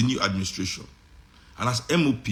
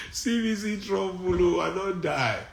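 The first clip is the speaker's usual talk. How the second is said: laughing; 7 semitones higher, 4 dB quieter, slower than usual. Real, not acted. Laughing at wife's jab.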